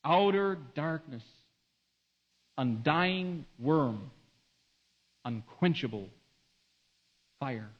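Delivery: slow, each phrase set apart with long pauses; solemn slow recap. Real, not acted. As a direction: let each image sink in with silence. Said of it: "slow solemn recap".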